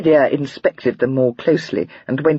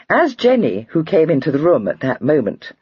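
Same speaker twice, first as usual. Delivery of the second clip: ordinary delivery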